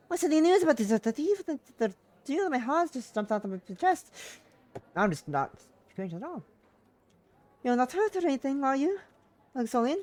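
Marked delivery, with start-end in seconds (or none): 0.1-4.1 put-on high, loud Russian-accented mother; worried mum, badly faked Russian accent; urgent high voice with attempted Russian accent. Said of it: "Russian accent". 7.6-10.0 high, louder mother's voice; raised-pitch fretting mum; worried mother. Acted. Mother questions daughter on the phone.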